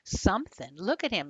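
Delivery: plain reading voice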